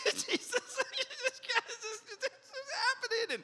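very high, slow squeaky whimper; hammed-up near-tears wail to Jesus; mock crying. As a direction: play a grown man about to cry. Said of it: "I was ready to cry".

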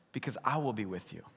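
quiet, hushed reassurance as God; God calmly answering doubts; soft reply as God. Retold or imitated. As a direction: drop the voice low and gentle. Pastor voices God answering softly.

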